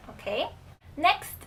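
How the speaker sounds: normal talking voice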